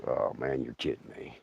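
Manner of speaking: whispered disbelief; barely voiced, in disbelief; hushed disbelief. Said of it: "It's like".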